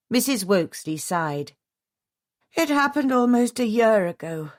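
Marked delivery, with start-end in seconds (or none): 2.5-4.5 weary. A narrator's reading. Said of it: "with great weariness".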